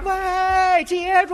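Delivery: loud high call after a thief; girl shouting after the thief. Acted. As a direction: yell it down the street. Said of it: "这姑娘就喊上了".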